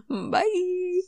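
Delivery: high, drawn-out sing-song bye; squeaky stretched farewell; silly high sign-off. Pretend. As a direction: chirp a long goofy goodbye up high. Silly sign-off of mic test.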